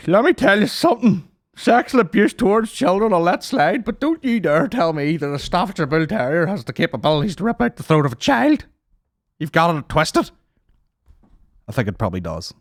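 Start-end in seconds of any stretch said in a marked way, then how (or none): 0.1-10.3 high, loud preachy outrage; mock indignant dog lover. Dog-loving listeners scolding the podcaster, in a high mock-indignant voice. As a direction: lecture like an offended commenter, hammed up. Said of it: "people are like".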